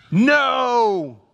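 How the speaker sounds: a loud, high, firm "No"; emphatic no. A narrator's reading. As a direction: slam the door with "No". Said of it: "he declares emphatically".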